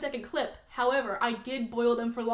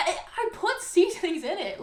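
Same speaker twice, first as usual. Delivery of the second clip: laughing through an exasperated defense; a bit higher and louder, breaking into a laugh; laughing protest; 7 semitones higher, 4 dB louder than usual. Real, not acted.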